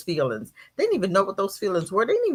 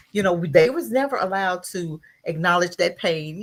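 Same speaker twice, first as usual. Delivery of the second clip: ordinary talk, nothing marked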